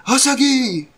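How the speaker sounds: a high, loud shouted war cry; battle cry. Acted. Yasuo unleashes his tornado attack with a loud, high war cry. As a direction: belt it out as the attack lands.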